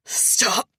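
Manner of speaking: high, loud desperate yell. A narrator's reading. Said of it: "he finally yells".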